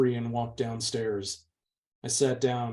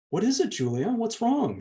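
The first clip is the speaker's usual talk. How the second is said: a higher, worried question; concerned sister; 11 semitones higher than usual. A narrator's reading. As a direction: ask with real concern for her sister. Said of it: "looking at me with obvious concern".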